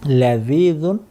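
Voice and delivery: আলাযযু with a held doubled z, slowed on the third take; teacher models আলাযযু slowly for students to repeat; model pronunciation. Shown as a demonstration. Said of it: "আমি উচ্চারণ করছি, আমার উচ্চারণ থেকে শুনে নিন".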